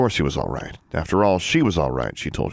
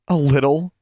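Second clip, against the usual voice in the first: higher, louder disbelieving echo; stunned repetition; 8 semitones higher, 4 dB louder than usual. A narrator's reading. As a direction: throw his words back in disbelief. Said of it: "she repeated in disbelief".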